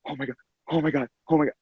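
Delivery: panicked murmur. Retold or imitated.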